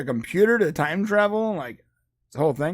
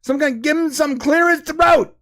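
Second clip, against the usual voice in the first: higher, exasperated mock plea; 8 semitones higher than usual. Listener exasperated by RFK's speech, higher. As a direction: beg someone to fix his voice. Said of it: "you're just like".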